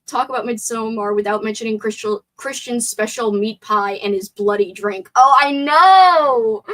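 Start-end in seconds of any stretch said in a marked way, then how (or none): 5.1-6.6 loud, drawn-out exclamation; emphatic agreement. Real, not acted. Streamer agreeing with chat about the bloody drink, exclaiming emphatically. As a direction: cry it out high and loud, stretching the words.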